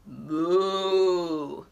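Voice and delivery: low mooing; cow moo. Retold or imitated. Reviewing the sounds, low cow moo. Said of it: "let's see if I got the sounds right".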